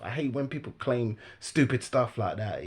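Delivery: ordinary talk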